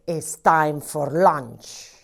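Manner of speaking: ordinary talk, nothing marked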